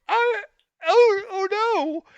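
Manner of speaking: squealed, hammed-up dismay; comic high-pitched wail of horror; horrified. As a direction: whimper it very high in mock despair. Acted horror at paralysed squirrel.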